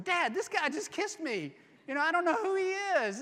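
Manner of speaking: high, fast rattled report to dad; flustered daughter. Retold or imitated. Rachel running to tell her father, high and flustered. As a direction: blurt it out in a fluster. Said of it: "I also like to look at this going".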